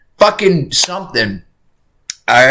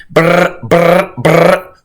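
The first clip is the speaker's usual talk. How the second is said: rolled brr in three short bursts; imitated phone buzz; much slower than usual. Streamer likening emergency calls to Dokkaebi, imitated buzzing. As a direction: trill it like a ringing phone. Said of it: "it's like Dokkaebi".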